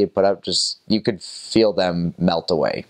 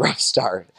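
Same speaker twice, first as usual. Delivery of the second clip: ordinary delivery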